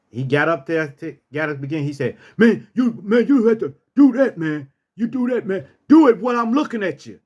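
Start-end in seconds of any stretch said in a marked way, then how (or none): 2.4-7.2 egging him on with a laugh; taunting street guy. Retold or imitated. Man taunting the narrator to hit him, fast and mocking.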